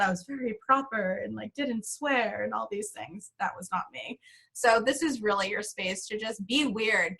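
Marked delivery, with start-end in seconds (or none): none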